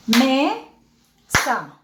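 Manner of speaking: "Mesa" slowly as me... sa, with a gap; syllable by syllable. Shown as a demonstration. Teacher does the exercise, syllable by syllable. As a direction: sound out "Mesa" in two beats. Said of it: "hagamos el ejercicio".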